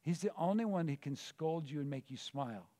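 usual speaking voice

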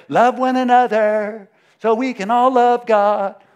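mock wrong version. Mocking the wrong order.

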